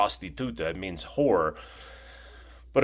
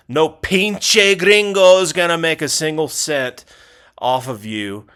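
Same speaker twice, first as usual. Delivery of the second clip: mock outburst; 7 semitones higher, 9 dB louder than usual. Mock Mexican slang outburst.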